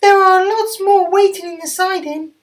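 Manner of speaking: very high, loud bright engine voice; very high cheery diesel voice; Mavis the diesel. Acted. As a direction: pipe up high and brisk. Mavis continues.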